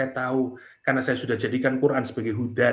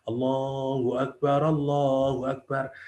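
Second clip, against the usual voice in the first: humble inner prayer; 4 dB quieter than usual. Pretend. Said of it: "hatinya menyatakan".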